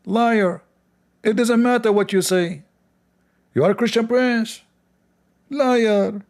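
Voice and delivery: high mocking sing-song; high mock accusation; mocking the chat. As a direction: taunt like the chanting crowd.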